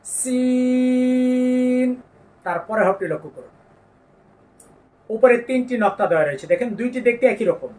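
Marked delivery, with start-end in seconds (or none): 0.0-1.9 long sustained সিন, hissed s; Arabic letter name. Shown as a demonstration. Teacher recites the letter for the class, drawn-out. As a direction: draw out সিন slowly. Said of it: "আমার সাথে সাথে একবার বলুন".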